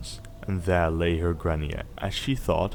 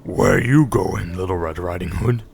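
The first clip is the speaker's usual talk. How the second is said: a lightly voiced woodman calling out, louder; 7 dB louder than usual. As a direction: hail the girl like a friendly woodman. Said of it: "said he".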